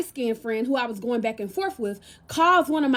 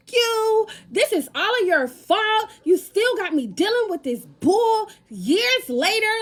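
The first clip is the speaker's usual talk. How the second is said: going off; 9 semitones higher than usual. Retold or imitated. Drunk at the club, going off on boyfriend in high angry voice. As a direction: blow up at the boyfriend. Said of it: "I'm so mad, I start going off".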